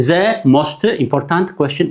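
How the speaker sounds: "The most important question" dragged out slowly, each letter pronounced; slow separate words. Shown as a demonstration. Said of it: "We don't read every letter separately". ("The most important question" ብዝግታ ፊደል ብፊደል)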